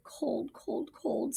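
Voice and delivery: tiny cold voices; shivering babies. Acted. Shivering babies telling Jack they're cold, high and soft. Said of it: "said the shivering babies".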